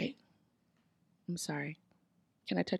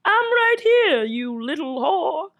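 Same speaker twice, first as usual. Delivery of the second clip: mock Romeo; 7 semitones higher, slower than usual. Romeo replies in other voice.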